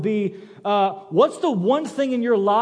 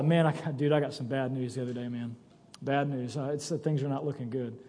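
mock gloom; 6 semitones lower, 8 dB quieter than usual. Bobby's gloomy bad news call.

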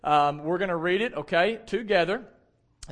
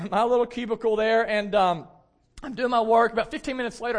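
usual speaking voice

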